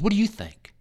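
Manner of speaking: high, insistent demand; yelling. Retold or imitated. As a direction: press the chaplain hard for an answer. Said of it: "He yelled at me a little bit more".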